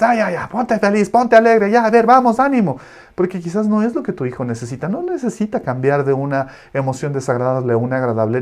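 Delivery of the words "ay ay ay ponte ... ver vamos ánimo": high, loud forced cheerfulness; mock-chirpy coaxing to cheer up; cheery parent pep talk. Parent brushing off a sad child, high and forced. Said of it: "no le digas".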